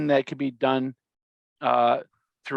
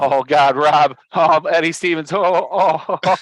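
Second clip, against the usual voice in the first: louder, delighted calling out of names; excited loud welcome; 8 dB louder than usual. Real, not acted. Welcoming the guest back, an excited loud cry.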